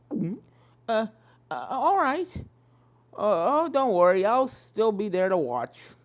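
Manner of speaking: put-on a young filly nervously agreeing. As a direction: stammer a little, unsure. Apple Bloom voiced, nervous.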